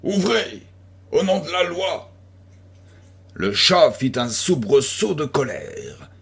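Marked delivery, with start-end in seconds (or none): 0.0-2.1 a high, gruff call through the door; rough shout. A narrator's reading. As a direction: bark the order like a policeman at the door. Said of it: "une voix rude cria".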